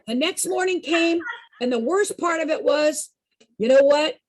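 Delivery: ordinary delivery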